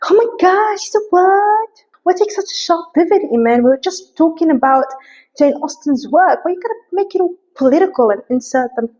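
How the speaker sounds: high-pitched exasperated objection; mock complaining viewer. Imagined viewer objects to political pivot, high mock exasperation. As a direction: whine it high, like an imagined critic.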